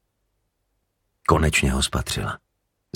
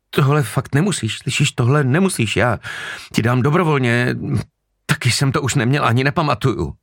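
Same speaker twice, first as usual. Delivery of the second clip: much higher pleading woman's voice; desperate lie; 11 semitones higher than usual. Acted. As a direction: lie desperately to save herself.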